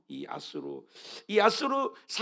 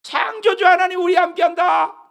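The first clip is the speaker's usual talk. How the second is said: full-voice shout, much higher; shouted proclamation; 13 semitones higher, 13 dB louder than usual. Real, not acted. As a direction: cry it out to the whole church. Congregation reading, climactic shout.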